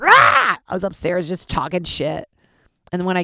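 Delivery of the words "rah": high, loud mock roar of rage; a short mock rage yell; angry roar. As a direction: let out a mock growl of fury. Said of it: "I was like".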